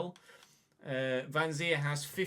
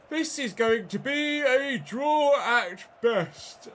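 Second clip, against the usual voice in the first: slow, higher and louder moan; exasperated whine; 9 semitones higher, 8 dB louder, much slower than usual. Real, not acted. Gamer moaning to viewers about the result, slow and loud. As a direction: drag it out in despair. Frustrated after conceding.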